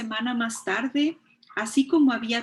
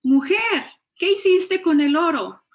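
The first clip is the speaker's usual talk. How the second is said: higher, louder call to his wife; 6 semitones higher, 5 dB louder than usual. A narrator's reading. Woodcutter calling his wife, raised call. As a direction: hail her from outside the house. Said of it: "Llamó".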